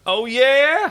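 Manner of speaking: sharp high rise on "yeah"; mock him with a manic lift. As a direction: Crichton challenging Crais, high and loud. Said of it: "slightly manic look".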